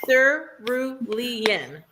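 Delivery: Cerulean said very slowly, syllable by syllable, like a teacher; clapped-out syllables. Retold or imitated. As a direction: clap out each beat of Cerulean for a struggling reader. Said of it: "she had to clap it out phonetically for me".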